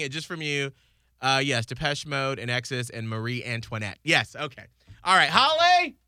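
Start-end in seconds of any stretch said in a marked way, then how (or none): 5.0-6.0 raised, higher summoning call. Real, not acted. Co-host summons colleague back into the studio, calling out. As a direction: call to someone outside the room.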